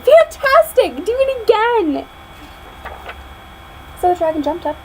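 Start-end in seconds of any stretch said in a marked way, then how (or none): 0.0-2.1 high, slow, loud cheering; excited shout. Acted. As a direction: egg the dragon on at full voice.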